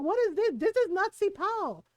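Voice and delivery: high, scoffing disbelief; mock outrage at fake sipao; a hammed-up huffy tita; indignant auntie.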